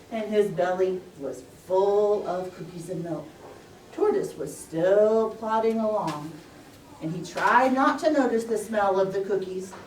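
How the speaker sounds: usual speaking voice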